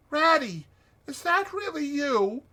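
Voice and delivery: high, thin feeble voice; faint hopeful little voice from a hole. A narrator's reading. Frightened Mole answering Rat from the hollow, feebly. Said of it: "came a feeble voice saying".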